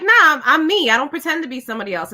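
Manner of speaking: brisk, offhand denial; quick dismissal. Retold or imitated. Guest recalls nearly falling into the trap, quick dismissal. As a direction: brush it off quickly, falling at the end. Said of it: "And then I stopped and I thought".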